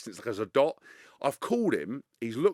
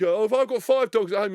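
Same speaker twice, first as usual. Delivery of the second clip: higher, louder mock small talk; chatty photographer; 8 semitones higher, 7 dB louder than usual. Photographer making small talk while snapping photos, chatty. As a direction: play the pushy chatterbox.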